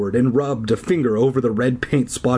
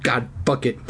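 usual speaking voice

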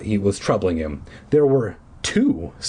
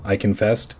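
ordinary delivery

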